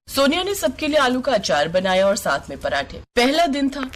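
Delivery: usual speaking voice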